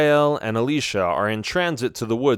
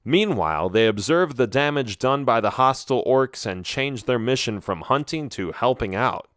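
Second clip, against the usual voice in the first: plain reading voice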